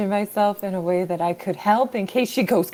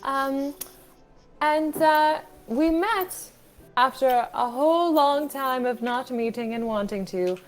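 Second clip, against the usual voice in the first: attempted British accent. Player recapping the last session for the group, attempted British accent. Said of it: "worse British accents".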